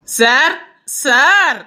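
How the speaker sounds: teasing, repeated heckler's call. Acted. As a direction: call insistently to make him turn round. Heckler calls out to passenger.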